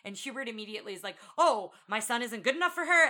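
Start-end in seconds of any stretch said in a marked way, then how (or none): 1.4-3.1 mock offended king.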